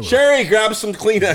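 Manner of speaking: hammed-up announcement of a tearjerker; overdramatic setup. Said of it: "you're setting it up too much".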